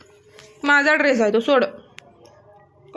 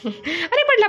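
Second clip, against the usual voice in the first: sudden high cry as the cat falls; surprised exclamation; 8 semitones higher, slower than usual. Real, not acted. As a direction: blurt it out in surprise.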